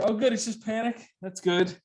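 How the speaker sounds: high, light mock relief; self-mocking relief at a panic attack. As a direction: sigh it out, relieved it's only panic. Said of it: "relieved self quote".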